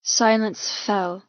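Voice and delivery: usual speaking voice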